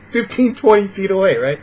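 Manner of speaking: normal talking voice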